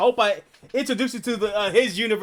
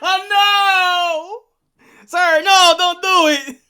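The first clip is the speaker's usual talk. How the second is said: mock alarm; 10 semitones higher, 8 dB louder, much slower than usual.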